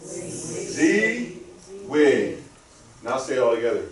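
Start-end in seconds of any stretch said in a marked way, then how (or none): 0.0-2.4 Z as a higher, drawn-out zee, then wig; last two syllables, Z and wig, sounded apart. Shown as a demonstration. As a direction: separate Z and wig with a pause. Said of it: "say the middle and the last".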